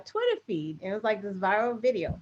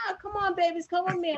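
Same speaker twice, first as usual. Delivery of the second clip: high-pitched mock coaxing; 7 semitones higher, faster than usual. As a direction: imitate a woman sweet-talking the girls. Retelling woman coaxing the girls.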